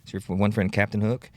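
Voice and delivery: ordinary talk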